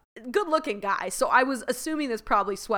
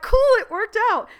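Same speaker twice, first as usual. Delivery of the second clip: much higher and louder, thrilled; delighted; 12 semitones higher, 9 dB louder than usual. Retold or imitated. Reacting to Mister Freckles' text, a high thrilled squeal. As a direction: squeal that it actually worked. Delighted the waiter texted back.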